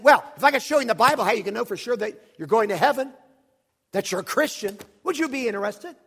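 brusque offer. Retold or imitated. Preacher expects a refusal to his Bible offer, loud brusque question. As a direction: push the question out forcefully. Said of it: "I said it about like that".

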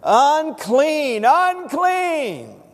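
loud, high, drawn-out warning call; leper's cry. Acted. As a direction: cry it out to warn everyone off. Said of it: "what would they cry?".

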